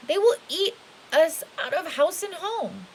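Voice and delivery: a hint of a fretful old woman; lightly voiced old woman, alarmed; old woman worrying.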